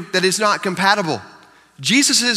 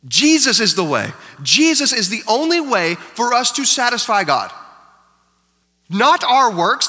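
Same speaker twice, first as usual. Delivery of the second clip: ordinary delivery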